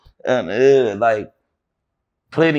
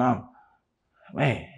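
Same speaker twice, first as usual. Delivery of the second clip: ordinary talk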